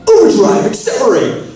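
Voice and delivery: loud, high, eager battle call of "Overdrive, accelerate!"; enthusiastic. Shown as a demonstration. Actor at season start shouting the morph call keenly. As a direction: call it out full of beans, pitch up high. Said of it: "you're full of beans and you're really enthusiastic".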